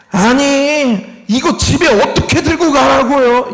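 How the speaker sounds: mock an ungrateful kid complaining about a gift; whiny complaint. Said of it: "불평하고 있는 거예요".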